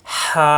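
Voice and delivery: হা with a strong airy rasp from the throat; long pharyngeal হা; throat-pressed h. Shown as a demonstration. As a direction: squeeze the throat on হা and hold the a. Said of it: "কণ্ঠনালীতে চাপ দিয়ে".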